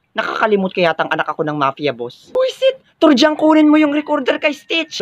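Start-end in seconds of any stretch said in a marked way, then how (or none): 2.4-5.0 very high acted panic; alarmed outburst. Monitor orders the recorder seized from Stitch, high alarmed cry. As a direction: burst out high and urgent.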